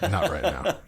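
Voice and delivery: high, laughing through the words; giggling dad brushing off his son; laughing reply. Retold or imitated. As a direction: laugh while saying no.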